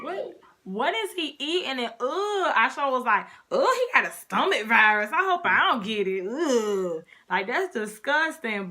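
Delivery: exaggerated mock revulsion; loud, swooping retold disgust; grossed-out disgust. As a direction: act out being repulsed by food. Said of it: "stuff was so nasty".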